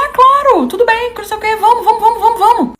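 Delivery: high, eager imitation of mother; hurried agreement. Mother rushing daughter out, mock-eager agreement. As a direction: mimic mom hustling someone along.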